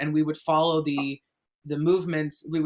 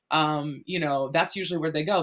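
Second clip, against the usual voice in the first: usual speaking voice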